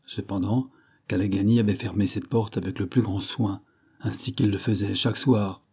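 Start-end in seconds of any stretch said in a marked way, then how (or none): none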